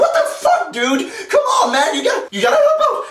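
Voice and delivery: high, strained pleading shout; acted-out frantic yell, pitch way up; exasperated yelling. Acted yelling at useless teammate.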